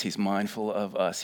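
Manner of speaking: ordinary talk, nothing marked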